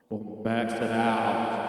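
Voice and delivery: slow words drowned in heavy reverb; reverb effect. Shown as a demonstration. Streamer showing off the reverb, slow and echoing. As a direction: let it boom and wash out in echo. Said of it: "You've got a reverb effect here".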